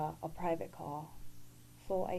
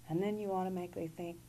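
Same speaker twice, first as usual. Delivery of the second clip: normal talking voice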